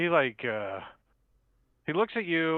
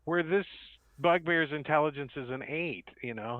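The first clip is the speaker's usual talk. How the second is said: normal talking voice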